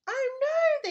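high, loud panicked cry; the bees cry out in terror; frightened cry. Acted. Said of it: "they cried".

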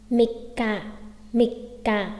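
"Mika" with a doubled k; "Mika" broken by a held k; geminate stop. Shown as a demonstration. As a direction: cut mi short, pause, falling ka in "Mika". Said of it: "gấp đôi gì nhỉ?".

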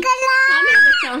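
loud, high call across the room. Real, not acted. Calling out to her baby.

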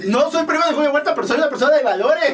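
playfully protest, breaking into laughter; mock indignation. Mock denial amid shared laughter.

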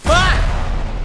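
high, short scream; high shout of alarm; startled scream. Real, not acted. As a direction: yell out in sudden shock. Startled in the game.

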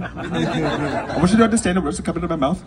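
ordinary talk, nothing marked

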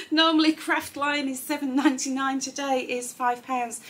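high, loud, laughing through the price. Real, not acted. Presenter recovering from the paper chaos, laughing. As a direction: giggle while reading the price.

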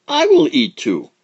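squeaky shouted claim; high child insisting loudly; high loud insistence. Acted. Quickfoot insists.

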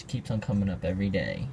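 usual speaking voice

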